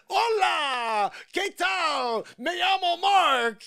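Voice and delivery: high shouted fake Spanish; hammed-up Spanish accent. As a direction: belt it in a hammy Spanish accent. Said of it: "I wouldn't be like".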